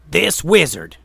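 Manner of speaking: loud, pointed call; Gray shouting to the trolls; yelled accusation. A narrator's reading. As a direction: call out the culprit. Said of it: "yelled Gray".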